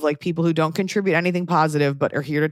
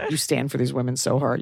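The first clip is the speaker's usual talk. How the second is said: ordinary talk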